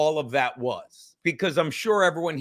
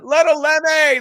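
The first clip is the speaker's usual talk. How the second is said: high, loud announcement of the name; big, pitched-up welcoming holler; excited call-out; 10 semitones higher, 8 dB louder than usual. Real, not acted. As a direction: shout the guest's name like a hype man. Calling out the guest's name.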